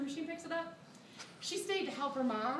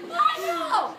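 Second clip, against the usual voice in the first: much higher and louder shriek; panicked agreement; 11 dB louder than usual. Retold or imitated. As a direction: shriek it back in shared panic.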